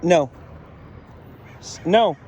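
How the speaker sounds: patient, insistent orders with long pauses; firm correction. Real, not acted. Trainer keeps correcting Frida until she sits, firm commands.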